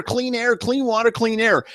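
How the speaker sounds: high mocking singsong slogan.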